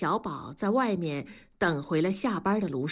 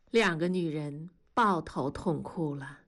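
usual speaking voice

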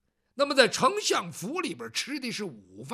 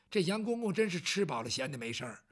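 ordinary delivery, nothing marked

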